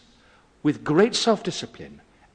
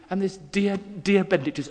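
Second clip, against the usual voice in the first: normal talking voice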